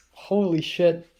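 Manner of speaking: ordinary talk, nothing marked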